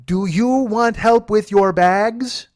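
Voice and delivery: high, very slow, loud offer; over-enunciate it as if they can't understand; terribly condescending. Retold or imitated. Driver offering passenger help with bags, condescending. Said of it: "sound terribly condescending".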